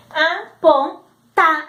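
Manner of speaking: "Apontar" stretched slowly, the last syllable long; slow sounding out. Shown as a demonstration. Teacher acting the child reading "Apontar" slowly. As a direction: drag it out syllable by syllable. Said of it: "o O vai ficar melhor".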